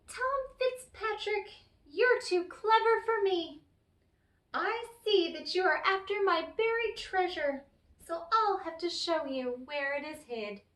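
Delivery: high little leprechaun giving in. Acted. The beaten leprechaun admits Tom is too clever, high voice.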